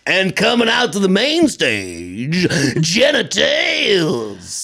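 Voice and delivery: slow booming announcer, last word shouted; cartoonish strip club announcer. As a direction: drag out the name like a hammy MC. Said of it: "that is cartoonish strip club announcer voice that I just did".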